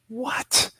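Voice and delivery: a disbelieving gasp of a question; stunned what. Retold or imitated. Hearing four and a half years.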